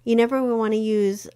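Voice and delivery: ordinary delivery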